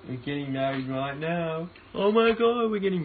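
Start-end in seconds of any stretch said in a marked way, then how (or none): 1.9-3.0 excited. Real, not acted. Excited at getting married.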